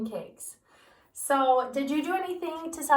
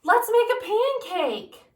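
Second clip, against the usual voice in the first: eager Jack; 10 semitones higher, 9 dB louder than usual. Acted. Eager boy voiced in story.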